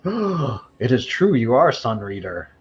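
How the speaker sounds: gasp, then a high, astonished "oh"; startled character voice, opening high and easing down; awestruck gasp. Acted. Game master voicing her jumping back, awestruck.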